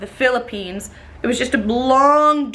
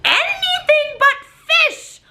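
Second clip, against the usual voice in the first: much higher, laughing through it; laugh-crying exasperation; 14 semitones higher than usual. Real, not acted. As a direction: laugh in disbelief.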